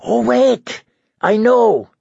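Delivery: high, mocking outburst; mock sudden realisation. A narrator's reading. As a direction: pipe up as if struck by an idea. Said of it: "He shouted".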